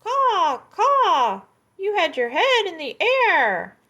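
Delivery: very high, loud cawing; shrill mocking crow. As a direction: caw it out like a crow.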